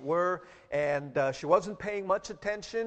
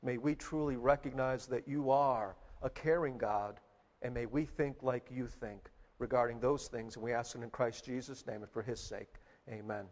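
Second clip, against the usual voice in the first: low, soft, measured praying voice; congregational prayer; 5 semitones lower, 7 dB quieter than usual. Real, not acted. Pastor praying with the congregation before the sermon, quiet prayer. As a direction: pray quietly, pitched low, fading on the Amen.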